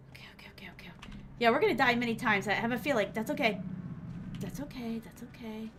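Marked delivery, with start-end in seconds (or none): 0.1-1.2 breathless whisper of okay; whispered self-calming. Real, not acted. Streamer whispering to calm herself after the scare. 4.4-5.7 much softer, trailing away; fading self-reassurance. Real, not acted. Streamer softly soothing herself after dying.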